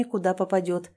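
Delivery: plain reading voice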